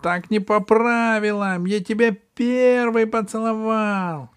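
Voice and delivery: high, whiny cat complaint; sulky protest. Acted. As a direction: protest like a sore loser.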